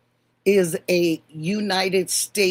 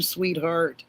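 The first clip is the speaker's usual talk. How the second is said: ordinary delivery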